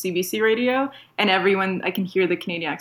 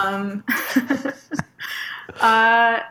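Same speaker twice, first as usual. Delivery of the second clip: ordinary talk, nothing marked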